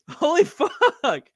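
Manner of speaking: high, slow laughing disbelief; shocked laughter. Real, not acted.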